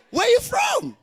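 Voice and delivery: high, loud shouted question; mock shout. Friends shouting at the newcomer, high and loud. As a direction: shout it up high across the room.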